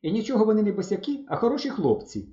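put-on high girl's voice, protesting; sudden exclamation. A narrator's reading. Valka standing up for the boys, exclaiming. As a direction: jump in to defend them. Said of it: "вигукнула раптом Валька".

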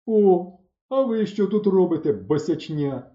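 loud, high start, dropping at the end; surprised exclamation. A narrator's reading. Bardadym noticing the boys, surprised shout. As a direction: exclaim at an unexpected find. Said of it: "здивовано вигукнув Бардадим".

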